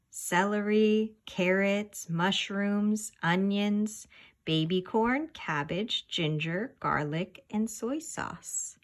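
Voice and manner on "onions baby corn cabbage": usual speaking voice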